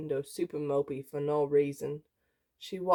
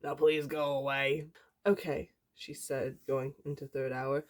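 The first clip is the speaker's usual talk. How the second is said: usual speaking voice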